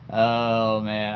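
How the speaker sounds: usual speaking voice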